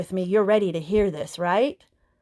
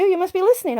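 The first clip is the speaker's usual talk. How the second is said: higher, louder stress on you; excited realization; 9 semitones higher, 4 dB louder, faster than usual. Retold or imitated. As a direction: hit "you" up high, surprised. Realizing the body listens.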